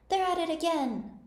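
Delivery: high, drawn-out shouted announcement; slow, high-pitched character shout; top-of-voice shout. A narrator's reading. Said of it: "shouted at the top of his voice".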